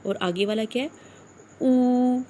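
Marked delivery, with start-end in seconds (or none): 1.6-2.2 "او" drawn out as a long oo, louder; firm, lengthened "او"; stretched vowel. Shown as a demonstration. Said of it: "اس کو تھوڑا سا کھینچ کر پڑھیں گے".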